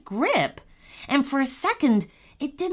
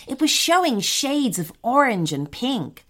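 ordinary delivery

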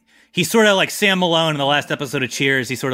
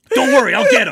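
squealing, laughing guard; high and loud through laughter; 7 dB louder, faster than usual. Acted. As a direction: crack up while promising.

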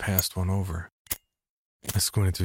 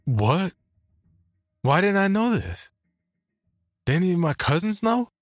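high-pitched, stunned questions; sputtering; 12 semitones higher, 4 dB louder than usual. Acted. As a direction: blurt it out in disbelief. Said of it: "I sputtered".

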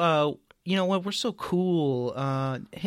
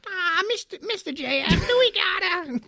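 put-on high whiny kid with a smoker's rasp; raspy kid voice; 4 dB louder than usual. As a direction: whine like a kid who smokes. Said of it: "Just like a little nine-year-old kid. With, like, a smoker's voice".

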